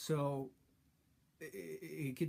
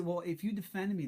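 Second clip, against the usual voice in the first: louder, higher challenge to her; retold raised voice; 6 semitones higher, 6 dB louder than usual. To girlfriend who claims to defend him, raised retort. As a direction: push back hard, voice up. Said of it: "And I would say".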